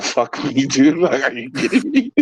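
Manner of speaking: high voice dissolving into laughter; retold disbelief, cracking up at the end; laughing disbelief. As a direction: start incredulous, break into giggles.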